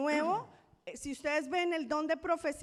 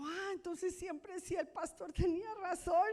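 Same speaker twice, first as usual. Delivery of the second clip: exclamación más aguda al caer en cuenta; sorpresa; 5 semitones higher than usual. Retold or imitated. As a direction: reacciona asombrada, recreando el momento. Reacts to doctor's correction. (asombro recreado en tono alto)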